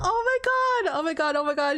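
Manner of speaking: high, loud, thrilled oh my god; thrilled squeal. Real, not acted. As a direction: squeal it out, unable to contain the joy. Reacting to baby gender news.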